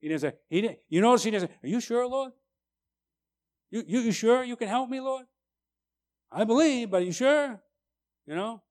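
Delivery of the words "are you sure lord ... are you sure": mock doubting voice, pitched higher and quick; wishy-washy doubter. Preacher mocking a doubter who asks Jesus, higher wishy-washy voice. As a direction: act out a timid, unsure petitioner. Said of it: "Wishy-washy?".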